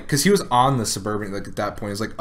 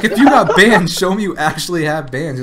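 much higher, louder dare; mock brother challenge; 7 semitones higher, 9 dB louder than usual. Retelling his brother calling the drunk guy's bluff, loud higher mock dare. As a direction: call his bluff loudly. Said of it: "He's like".

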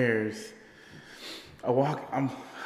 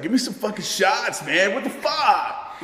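put-on drunk friend; 9 semitones higher, 5 dB louder than usual. Drunk friend demanding shots at the party, high mock voice. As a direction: slur it like the rowdy drunk. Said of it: "he's like".

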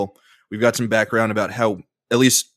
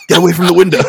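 higher, louder, laughing through it; laughing quote; 7 semitones higher, 9 dB louder, much faster than usual. Retold or imitated. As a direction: quote it while cracking up. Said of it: "we used to quote that all the time".

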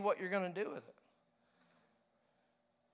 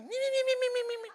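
very high squeaky giggling "me, me, me"; chipmunk laugh; much faster than usual. Retold or imitated. Pastor imitating his wife's holy laughter, very high chipmunk giggle. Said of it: "laughing at me like a chipmunk".

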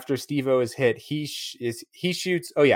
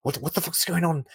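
put-on high, baffled South African accent; 8 semitones higher than usual. Host mimics Vern in the film, South African accent. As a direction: imitate a bewildered man in South African accent. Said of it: "it's very good hearing him just like".

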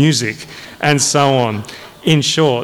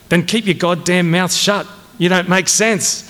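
higher-pitched, exasperated command; angry order; 6 semitones higher than usual. Acted. Colonel ordering his major to be silent, angrily. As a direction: shut a subordinate up.

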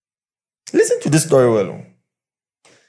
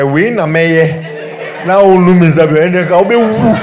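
loud, laughing local-language taunt; mocking laugh; 6 semitones higher, 11 dB louder, slower than usual. Preacher mocking the defiant wife's reply, laughing. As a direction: cackle it out in mockery.

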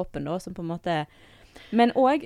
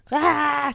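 mock child scream; 8 semitones higher, 6 dB louder than usual. Mother mimics a child's scream.

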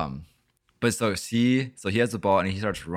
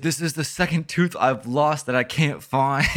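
put-on higher, slow, whimpering little-kid voice; whiny crying kid; 7 semitones higher, 5 dB louder than usual. Neighbor kid whining about his lost tooth, higher and slow. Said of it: "this is what he says verbatim".

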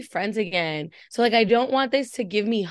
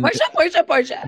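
excited; 9 semitones higher, 5 dB louder than usual. Real, not acted. Fan cheering for Portia.

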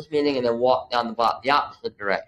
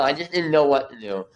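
ordinary delivery

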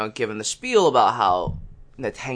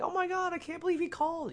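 very high, soft mock squeal; hushed high-pitched gushing, quick at the end; excited friend; 10 dB quieter, faster than usual. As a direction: imitate a thrilled girlfriend in a tiny high voice. Friend's excited reaction, acted out.

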